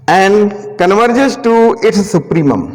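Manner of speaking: very slow, word by word for note taking; slow dictation. Shown as a demonstration. Teacher dictating the theorem statement to students, very slow. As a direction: read the theorem out slowly so they can copy it.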